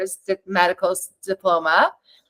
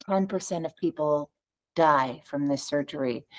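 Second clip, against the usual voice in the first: lower, softer, grim statement of the odds; French surgeon quoting grim odds, low and soft; blunt French doctor; 5 semitones lower, 7 dB quieter than usual. Retold or imitated. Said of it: "they're like".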